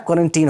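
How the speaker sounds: put-on क्वारंटीन with a long "ee" in the last syllable, "-teen"; corrected pronunciation. Shown as a demonstration. Teacher confirming the actual pronunciation to students, क्वारंटीन ending "-teen". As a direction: end क्वारंटीन like the word "teen". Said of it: "इसका actual pronunciation होता है".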